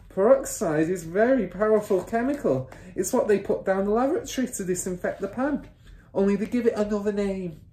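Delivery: high-pitched matronly explaining; mother's voice. A narrator's reading. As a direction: lecture him as the wife. Mother lectures husband on peroxide.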